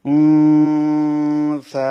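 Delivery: উন সা chanted slowly and loud, nun in the nose; slow tajweed chant; ikhfa nasal hold. Shown as a demonstration. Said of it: "সেই জন্য নুনটা গুণনা করতে হবে".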